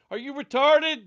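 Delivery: sudden loud high yell; loud shout. Real, not acted. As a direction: blast the question out loud. Mocking shout in argument.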